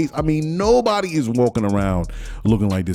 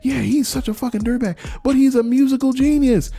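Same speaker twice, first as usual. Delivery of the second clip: high gushing gossip voice, much higher; mock gossiping fan; 13 semitones higher than usual. Gossiping fans chattering about [name]'s new record, high mock gush. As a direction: squeal it like a fan who can't stop gossiping. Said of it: "while they're saying".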